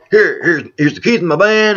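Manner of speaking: high, loud, hurried handover; urgent retold order. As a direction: act out an urgent bartender.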